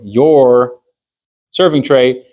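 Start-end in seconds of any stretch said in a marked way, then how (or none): 0.0-0.8 "your" repeated firmly on its own; stress on YOUR. Shown as a demonstration. Teacher repeats the key word about ownership, stress on "your". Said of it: "I'm emphasizing the word your".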